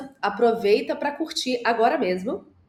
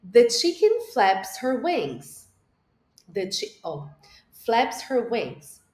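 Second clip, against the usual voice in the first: slow model sentence; much slower than usual. Shown as a demonstration. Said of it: "Bora pra fase três?".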